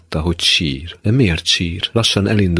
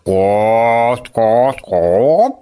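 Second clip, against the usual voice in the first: high clucks climbing much higher; chicken imitation; 5 semitones higher, 3 dB louder, much slower than usual. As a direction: cluck like a hen, rising sharply. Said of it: "halkan azt mondta". (kotkodácsoló csirke utánzása)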